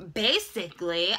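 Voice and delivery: normal talking voice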